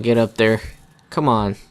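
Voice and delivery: usual speaking voice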